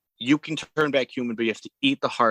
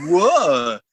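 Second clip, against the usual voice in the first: loud, higher "what" of shock; mock startled yelp; 4 semitones higher, 7 dB louder than usual. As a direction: act out a startled yell. Retold yelp when scratched.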